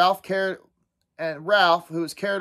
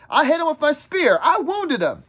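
high-pitched, insistent boast; indignant; 9 semitones higher than usual. Acted. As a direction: protest that the glory is yours. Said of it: "Ralph said indignantly".

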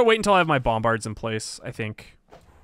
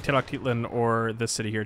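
usual speaking voice

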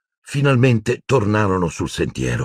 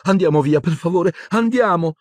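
high, urgent frightened pleading; terrified Hermione; 13 semitones higher than usual. A narrator's reading. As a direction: plead to leave, voice climbing higher. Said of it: "intervenne Hermione atterrita". (supplica atterrita, voce acuta)

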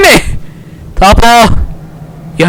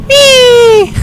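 mock scared gasp. Real, not acted.

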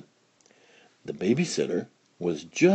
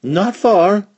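high, bright motherly voice; fussy mother pig, higher and brighter; 11 semitones higher, 6 dB louder than usual. Acted. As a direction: lift the pitch for the cheerful mother pig.

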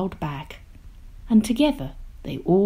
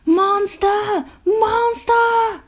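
high, excited calls; calling out; 4 dB louder, much slower than usual. A narrator's reading. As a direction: call after him in a squealing cry. Trio calling after the monster.

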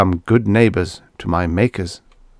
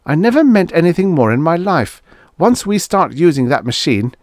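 raised, emphatic insistence; desperately earnest; 8 semitones higher, 4 dB louder than usual. A narrator's reading. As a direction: insist you are deadly serious, pitch high. Acted argument, earnest desperate warning.

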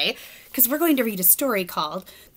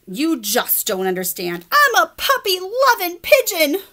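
higher, louder pigeon insisting; pleading pigeon; 8 semitones higher, 4 dB louder than usual. Acted. Pigeon insisting he loves puppies, pleading. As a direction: insist on it proudly.

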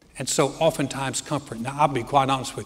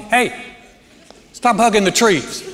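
higher, louder fatherly order; stern dad yell; 7 semitones higher, 7 dB louder than usual. Retold or imitated. Father to young son hugging trees, stern loud order. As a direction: bark it like a dad at his son. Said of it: "the dad in me comes up".

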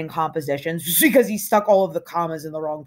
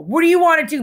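annoyed; 11 semitones higher, 6 dB louder, faster than usual. A narrator's reading. Stradlater protesting in headlock.

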